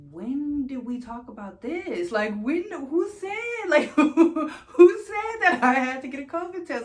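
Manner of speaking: loud, high, laughing disbelief; incredulous retort. Retold or imitated. Patient challenging the nurses over a surprise test, loudly incredulous. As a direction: push it up into a disbelieving, laughing outburst. Acted outraged reply to covid test.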